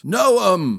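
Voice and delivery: calling a name across the mist. A narrator's reading. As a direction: call out for the lost lad. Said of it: "called the prince".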